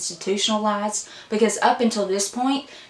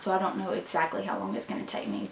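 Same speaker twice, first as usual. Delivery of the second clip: usual speaking voice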